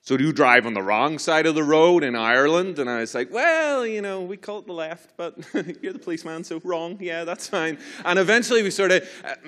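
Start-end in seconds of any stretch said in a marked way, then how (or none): 0.0-2.8 loud American state trooper accent. Shown as a demonstration. Trooper questioning Irish driver about the road, loud American accent. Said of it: "Should do an American accent. Let me get it". 3.3-7.8 high, hedging, half-laughing climb-down; nervous placating. Retold or imitated. Irish driver giving in to the policeman, nervous placating.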